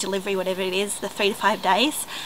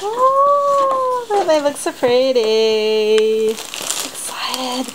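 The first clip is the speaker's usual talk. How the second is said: delighted gasp; 4 semitones higher, much slower than usual. Real, not acted. Vlogger seeing her group's new dance tops, delighted gasp. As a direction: marvel at the tops.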